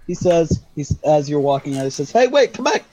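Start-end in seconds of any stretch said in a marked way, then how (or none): 2.1-2.8 calling out. Acted. Shopkeeper calls player back.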